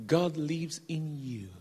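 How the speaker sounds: slow, low and soft; gentle reassurance. Retold or imitated. Preacher explaining how God speaks, gently. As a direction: let each word land gently and slowly.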